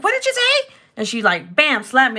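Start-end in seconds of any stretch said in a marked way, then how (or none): 0.0-0.7 much higher, louder outraged demand; mom's angry question. Retold or imitated. Mom to grown daughter, outraged, much higher and louder. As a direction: catch her child cussing, higher and louder. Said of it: "And she's like".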